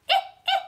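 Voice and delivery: very high, loud cackling eh; witch cackle. Shown as a demonstration. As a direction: squeal the eh like a witch. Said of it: "make the sound eh".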